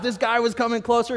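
ordinary talk, nothing marked

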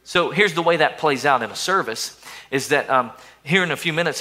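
ordinary delivery

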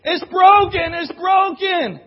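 preacher parodying despair, loud mock wail; mock wailing shout. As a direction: wail it like a child over spilt milk. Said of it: "that's how we could sound".

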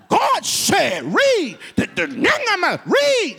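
loud, high shouted command; the reader shouting back, loud; reader's shout. Retold or imitated. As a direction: belt it back at the preacher. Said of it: "And then she said".

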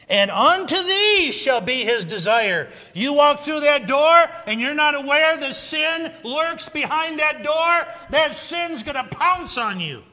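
loud, high, full-force pulpit preaching; fiery preaching. Acted. Preacher rousing his congregation, shouting at full force. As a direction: thunder it out to the congregation, building to a roar. Preaching warning about sin.

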